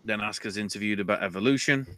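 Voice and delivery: usual speaking voice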